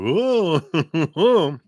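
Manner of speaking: high playful ooh, laughing; teasing ooh. Real, not acted. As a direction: tease with a rising ooh. Teasing about older lover.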